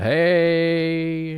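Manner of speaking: high, drawn-out mock hey; oversold greeting. Answering a phony acquaintance in passing, exaggerated high hey. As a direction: stretch out a fake friendly hello, pitched high. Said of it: "you're overselling it a bit much".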